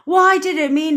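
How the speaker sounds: high, loud imitation UK accent. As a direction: imitate a friend in a put-on UK accent. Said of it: "That was my U K accent".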